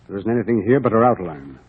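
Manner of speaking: ordinary talk, nothing marked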